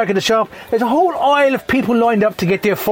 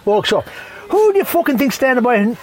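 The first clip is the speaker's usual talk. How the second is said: ordinary talk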